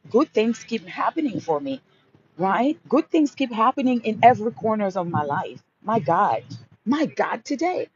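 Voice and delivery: high, bright-eyed rush of thrilled gratitude; gushing affirmations. Shown as a demonstration. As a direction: gush about winning like it's all happening now. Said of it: "That's how I talk".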